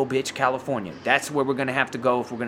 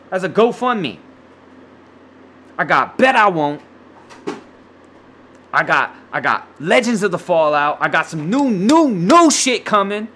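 higher, louder pleading hype to the listeners; hyped-up plea; 4 semitones higher, 4 dB louder than usual. Real, not acted. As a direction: beg the audience with rising energy.

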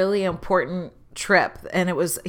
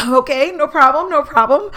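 higher, louder eager "no problem"; quick, keen agreeing; overly eager to please; eager reassurance; 7 semitones higher, 4 dB louder than usual. Retold or imitated. Retelling own eager reply.

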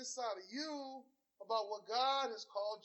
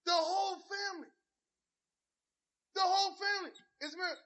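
excited exclaiming; 7 semitones higher, 4 dB louder than usual. Real, not acted. Proud exclaiming about family.

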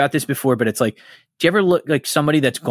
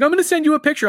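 much higher put-on voice; mock Kelly voice; much faster than usual. Quoting Kelly offering a sad photo, high mock voice. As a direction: play the tearful friend, pitched way up. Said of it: "she's like".

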